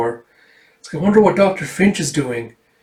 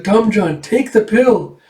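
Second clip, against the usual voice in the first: high, louder and slow; urgent girl; 8 semitones higher, 4 dB louder, slower than usual. Acted. Erica urges Dom John to take the pill, high and slow. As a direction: plead slowly and firmly.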